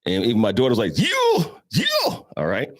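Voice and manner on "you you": very high-pitched cheering squeal; imitated excited whoop. Daughter watching dad play ball, high excited whoop. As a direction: whoop like an impressed spectator.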